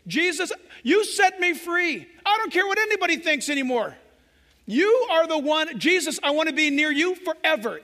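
fervent plea. Acted. Believer's cry to Jesus.

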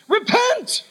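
sharp, very high warning call; a control tower warning a plane to turn back; urgent radio call. Retold or imitated. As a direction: call the pilot back from danger at once.

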